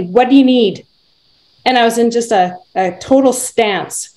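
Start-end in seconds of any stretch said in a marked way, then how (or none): 0.0-0.9 quoting a firm challenge; retold firm question, slightly raised. Woman confronts a man coming after her, firm. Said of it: "I just said very firmly".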